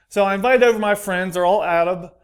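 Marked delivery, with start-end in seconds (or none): none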